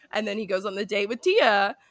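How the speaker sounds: ordinary talk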